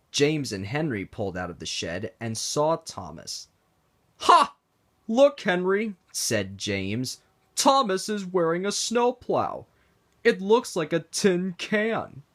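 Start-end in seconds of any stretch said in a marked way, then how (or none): 4.2-4.6 a sharp jeering laugh, high and loud; mocking ha. James mocking Thomas's snowplow, jeering laugh. 5.1-12.2 teasing, higher pitched jeer; mocking. James teasing Thomas about his snowplow, mocking. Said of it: "chuckled and chortled".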